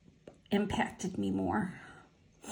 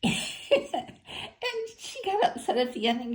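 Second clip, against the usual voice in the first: laughing; 7 semitones higher than usual. Real, not acted. Laughing while retelling friend's reaction.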